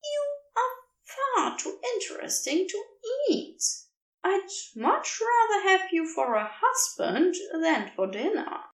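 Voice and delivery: high, fond teasing cherub voice; a creature letting a human down gently; playful cherub. A narrator's reading. Aziraphale teasing Crowley that vows won't hold, high fond voice.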